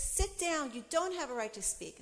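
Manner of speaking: loud, high, angry order; judge's stern command. Retold or imitated. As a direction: snap it like a furious judge. Judge ordering her to sit.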